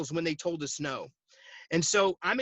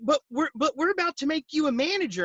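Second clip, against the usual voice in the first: stammering, much higher-pitched objection; flustered protest; 9 semitones higher, 4 dB louder, faster than usual. Retold or imitated. Manager to quitting agent, flustered protest. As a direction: imitate a dismayed boss pleading.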